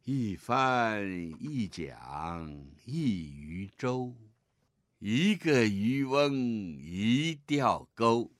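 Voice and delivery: very slow model reading for the class; lead reading. Shown as a demonstration. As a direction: read it slowly so the class can follow. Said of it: "来，我们现在一起来读一下啊". (领读，语速很慢)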